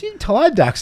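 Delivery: high, sing-song customer teasing; mock customer voice. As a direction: squeak it out like a nosy customer. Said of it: "Or a customer goes".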